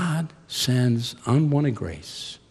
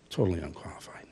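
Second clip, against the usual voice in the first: whispered aside; 6 semitones lower, 12 dB quieter, faster than usual. Acted. Quiet aside on Jonah.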